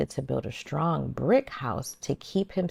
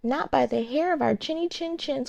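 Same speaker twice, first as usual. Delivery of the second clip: higher, squeaky frightened pigs; 9 semitones higher than usual. A narrator's reading. Two frightened pigs crying at the wolf, higher. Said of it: "cried the frightened little pigs".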